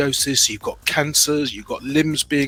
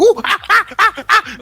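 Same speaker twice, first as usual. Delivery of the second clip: much higher and louder monkey hooting, ooh ah ah; monkey noises; 7 dB louder than usual. Pretend. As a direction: hoot like a silly monkey for the kids. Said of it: "monkey in the mirror, you go".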